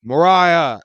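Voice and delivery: sick and annoyed, yelling for his water; angry call. Retold or imitated. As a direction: yell Mariah across the flat, fed up. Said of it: "I'm starting to get pissed off".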